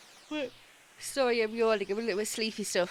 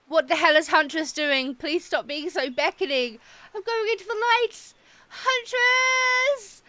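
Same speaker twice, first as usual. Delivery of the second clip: higher and louder, building to a Huntress cry; flustered excitement; 8 semitones higher, 8 dB louder than usual. Real, not acted. Scene: streamer flustered by the flirting Huntress, rising to a shout. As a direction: get swept away, flustered.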